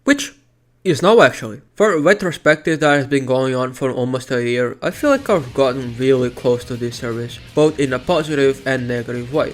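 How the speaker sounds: loud, steady outro voice; video outro narration. Acted. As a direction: read the outro to camera. Outro recorded louder.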